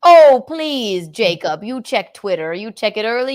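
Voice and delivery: normal talking voice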